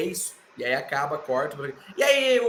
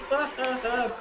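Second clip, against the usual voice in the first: short scornful chuckle; mocking laugh; 7 semitones higher, 5 dB quieter than usual. Roman laughing at Punk's request, mocking. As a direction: laugh him off like a smug chief.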